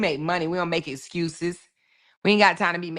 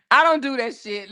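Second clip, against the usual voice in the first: ordinary delivery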